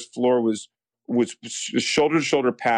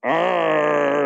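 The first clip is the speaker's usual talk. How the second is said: drawn-out, higher "arr" growl; reluctant mock growl; 5 semitones higher, 3 dB louder than usual. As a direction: growl while resisting doing it. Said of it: "I'm trying not to do it".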